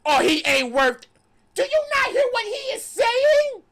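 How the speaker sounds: very high, loud what of disbelief; can't believe what you just heard; incredulous outburst. Real, not acted. Host reacts in disbelief to his co-host's comment.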